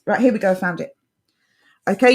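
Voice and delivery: ordinary delivery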